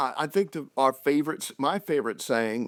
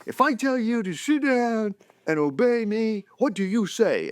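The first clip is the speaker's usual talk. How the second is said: higher, firm drill-sergeant dad voice; commanding dad; 9 semitones higher than usual. Retold or imitated. Dad drilling his little kids on obedience, mock stern. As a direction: bark the question like a playful drill sergeant.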